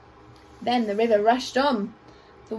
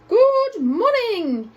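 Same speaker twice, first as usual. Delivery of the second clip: higher, louder, slow trumpeted greeting; trumpeting elephant; 9 semitones higher, 5 dB louder, much slower than usual. A narrator's reading. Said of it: "The elephants would trumpet".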